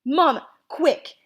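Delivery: high, loud urgent call; urgent alarm. Acted. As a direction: call your mother in alarm. Boy rushes in for help.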